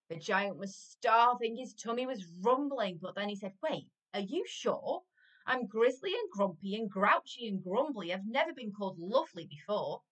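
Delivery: lively, emphatic rhyming storytime voice; story reading to children. A narrator's reading. Adult reading a giant story to children, animated storytelling. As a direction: read it out with bouncing, punched words. Reads picture book to children.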